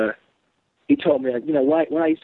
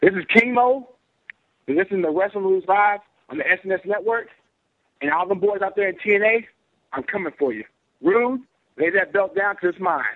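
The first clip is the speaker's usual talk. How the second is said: wrestling promo; 6 semitones higher than usual. Pretend. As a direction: cut it like a cocky wrestler. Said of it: "get you to cut a promo".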